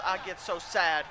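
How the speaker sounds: ordinary talk, nothing marked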